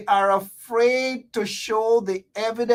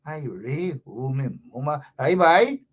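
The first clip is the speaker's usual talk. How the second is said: mock wailing lament; 6 semitones lower than usual. Shown as a demonstration. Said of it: "say it with some sort of passion".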